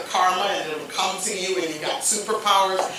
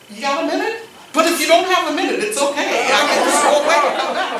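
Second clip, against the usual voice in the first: nervous rush; 6 semitones higher, 6 dB louder, faster than usual. Retold or imitated. Nervous ask of the boss.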